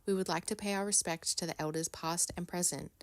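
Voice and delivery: soft, low-key formal read; quiet, measured podcast preamble; formal acknowledgement reading. Acted. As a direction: read a solemn statement softly and evenly. Reads an acknowledgement of country.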